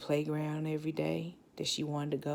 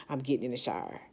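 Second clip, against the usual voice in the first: ordinary delivery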